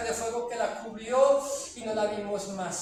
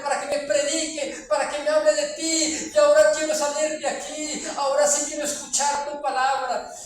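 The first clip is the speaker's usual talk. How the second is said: loud, raised, pleading cry to the Lord; desperate crying out; 3 semitones higher, 7 dB louder than usual. Retold or imitated. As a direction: beg at the top of your voice from the pit. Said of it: "y gritaba y llamaba al Señor".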